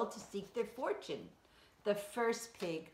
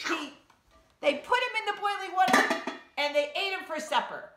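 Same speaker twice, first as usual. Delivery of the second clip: excited storytelling; 6 semitones higher, 6 dB louder than usual. A narrator's reading.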